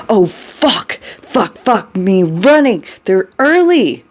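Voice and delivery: high-pitched panicked cursing, climbing to a cry; frantic, rising alarm; terrified. A narrator's reading. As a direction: swear in mounting panic. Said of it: "He is absolutely terrified".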